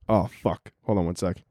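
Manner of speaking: ordinary delivery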